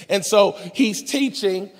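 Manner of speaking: usual speaking voice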